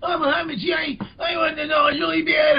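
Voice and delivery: high, pleading whine with a crying edge; whining Dennis. Retold or imitated. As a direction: beg the guru like a wounded child. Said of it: "Dennis is whining again".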